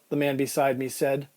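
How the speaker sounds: ordinary delivery, nothing marked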